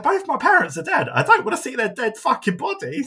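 squeaky exaggerated outrage at the idea; hammed-up high voice of a friend refusing an open casket; imitating friend. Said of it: "she was like".

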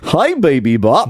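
slow, goofy purple dinosaur voice; cheery kids' TV dinosaur greeting, drawn out; Barney impression. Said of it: "That's a really good Barney impression".

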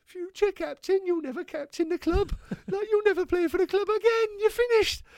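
high mock gaffer. Teammate mimicking the gaffer's threat, high mock voice. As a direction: parody the boss's doom-laden warning. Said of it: "Gaffy's going".

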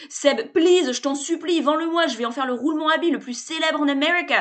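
loud, high, cheerful bluster; brash salesman. A narrator's reading. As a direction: barge in like an overfamiliar hustler. Sébastien's pitch.

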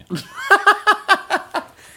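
loud, high burst of laughter; big high laugh. Real, not acted. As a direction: crack up at the absurd memory. Laughs at his own story.

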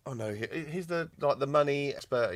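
usual speaking voice

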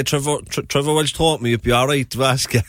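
playful mock appeal. Podcast guest pretends to approach Roy for an interview, playful mock appeal. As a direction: put on an eager approach to a celebrity.

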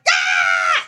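low, loud mock yell of protest; imitated cranky kid yell. Mimicking upset kids in a Vegas casino, a loud low mock yell. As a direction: bellow it like a furious tired kid.

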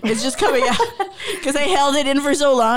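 nervous laughter easing the tension; laughing it off. Real, not acted. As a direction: laugh through the leftover emotion. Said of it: "Trust me".

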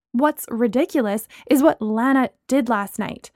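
usual speaking voice

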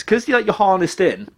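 ordinary talk

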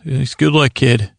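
normal talking voice

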